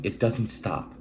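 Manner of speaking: ordinary talk, nothing marked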